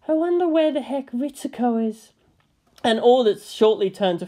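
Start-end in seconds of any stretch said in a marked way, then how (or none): 0.1-2.1 high, mildly exasperated woman; lightly voiced Misato. A narrator's reading. Misato looking for her friend, high and a bit fed up. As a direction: wonder aloud in Misato's voice.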